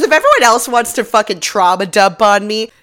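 loud, fed-up snap at everyone; fed-up outburst. Retold or imitated. Olivia snapping at the squad, loud and fed up. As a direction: vent like she's had enough. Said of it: "She goes".

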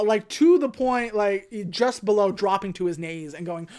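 usual speaking voice

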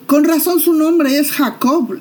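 voz alta de reproche; enojo amargo. Acted. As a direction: escupe el nombre con rencor. Esaú furioso por el engaño. (Esaú indignado contra su hermano)

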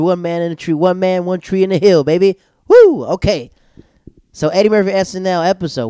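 loud, high celebratory outburst; excited hype. Real, not acted. Podcaster celebrates getting forty-five minutes in, loudly excited. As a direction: hype yourself up, building to a whoop.

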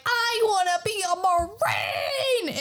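high, drawn-out mock declaration; wailed dream. Captive Colby declares his dream to Luffy, hammed-up high wail.

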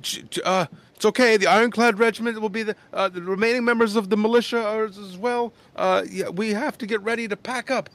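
high, loud, stammering mayor; the mayor flustered by the crowd's questions. Acted. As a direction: stumble to calm everyone. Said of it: "flustered beyond belief".

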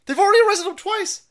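already yelled up high and loud; outraged shout. Real, not acted. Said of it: "repeats the line louder".

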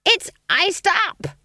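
high, drawn-out scream. A narrator's reading. Old witch on frozen broomstick screams to her friend. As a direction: scream it high and slow, stretching the words. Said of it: "screamed Granny".

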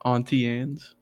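"Auntie" opening on an ah vowel; "Auntie Anne's" with Auntie pronounced ahn-tee. Shown as a demonstration. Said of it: "how'd you say that".

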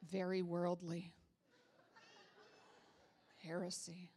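low, soft judgmental muttering; mock disapproval. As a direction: murmur it like a scandalized churchgoer. Mimics disapproving churchgoers.

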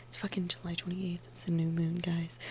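very soft, near-whispered aside; hushed aside. Real, not acted. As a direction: drop right down as if sharing a secret. Hushed aside while manifesting.

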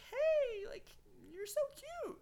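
very high-pitched imitated girly greeting. Teller voices girls approaching him.

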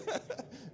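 high short chuckle; a quick laugh at yourself. Real, not acted. Pastor laughing at his own remark, light chuckle.

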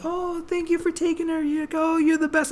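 put-on high, sweet thanks in an old lady's voice. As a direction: imitate a grateful old lady, high up. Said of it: "and she's like".

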